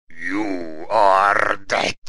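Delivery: very slow, loud, drawn-out doom voice; stretched-out, ominous Grim Reaper; voice of Death. Acted. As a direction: intone it like Death pronouncing doom. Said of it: "das könnte ja der Tod sein".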